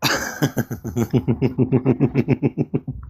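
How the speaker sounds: long rapid chuckling run; laughter. Real, not acted. Co-host amused by the uncle's tale, laughter. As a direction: laugh helplessly at a friend's story. Reacts to the caller's insult story.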